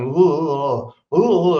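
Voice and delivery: breathy ooh-ing like a medium going into trance; hint of a psychic's trance moans; mock channeling moans. Narrator imitating the psychic starting to channel. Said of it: "he starts going".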